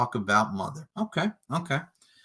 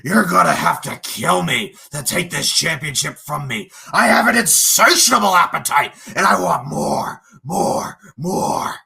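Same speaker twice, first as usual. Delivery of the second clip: higher, louder whiny mock voice building to a fake sob; mocking imitation of opponent; 5 semitones higher, 6 dB louder than usual. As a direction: mimic the rival like a dramatic crybaby. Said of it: "start talking like this".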